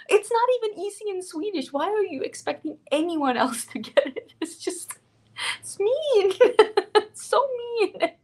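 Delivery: high-pitched, incredulous laughing complaint; laughing protest. Real, not acted. Streamer griping to viewers about a cruel product name, laughing.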